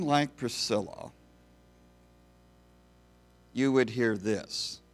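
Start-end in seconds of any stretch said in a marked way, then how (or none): none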